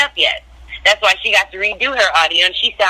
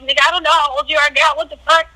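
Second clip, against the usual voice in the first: higher, fast, incredulous snap; reenacted heated retort, pitched up and quick; retold blunt outburst; 8 semitones higher than usual. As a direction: throw it back at her in disbelief. Retold confrontation, looking her dead in the eyes.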